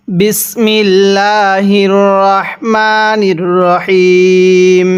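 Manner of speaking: drawn-out Quranic recitation; slow recitation. Shown as a demonstration. Teacher opens the lesson with slow recitation. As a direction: chant it slowly and evenly.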